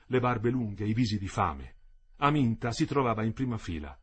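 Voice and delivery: plain reading voice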